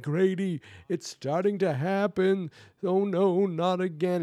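high, wailing imitation of dread; mock panic. [name] to Grady as the bad dream starts, mock panic.

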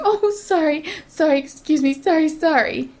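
high, mocking string of apologies; puppy-dog apologizer. Stranger apologizing in the grocery store, mocked puppy-dog pleading. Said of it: "with big puppy dog eyes and go".

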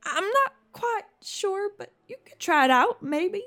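high, hesitant, softened reply; doubtful aunt. Acted. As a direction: humour your nephew uncertainly in a high voice. Aunt doubtfully answers her nephew.

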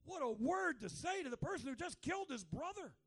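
loud, high astonished exclaim; preacher marveling at God's words; amazed. Retold or imitated. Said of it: "I'm like".

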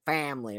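stretched first vowel on "family"; "family" as a mimicked accent, first syllable held; imitated "family". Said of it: "like the way he said family".

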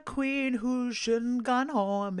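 high, slow put-on voice; whiny mock complainer, drawn out; lightly voiced annoyed fan; mock viewer voice. Quoting a viewer in a voice.

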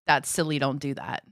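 mock level-headed. Her co-host as the calm one, low dismissive. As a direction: brush it off low and matter-of-fact. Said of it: "the more level-headed".

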